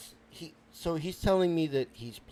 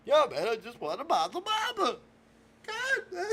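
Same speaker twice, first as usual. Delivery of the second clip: high wail breaking into sobs; whine, then break down crying; 9 semitones higher than usual. Acted. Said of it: "Oh, now he's crying".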